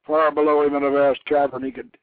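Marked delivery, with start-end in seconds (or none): none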